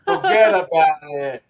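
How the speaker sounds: drawn-out Noo Yawk forget about it; slow hammy New Yorker drawl; mock New York. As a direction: lay the Brooklyn accent on thick. Hammed-up New York catchphrase.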